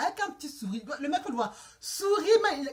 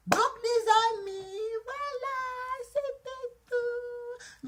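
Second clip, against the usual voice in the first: high, slow sing-song farewell; drawn-out sign-off; 11 semitones higher, much slower than usual. Real, not acted. Vlogger closing the story for her friends, drawn-out sing-song. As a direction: stretch each last syllable high and long.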